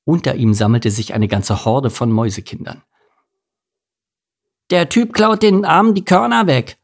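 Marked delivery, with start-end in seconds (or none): 4.7-6.8 put-on very high accusing kid voice; high-pitched indignant little ringleader; little rascal. A narrator's reading. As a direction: tattle like a small mouse boy. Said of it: "erklärte gerade ein kleiner Bengel".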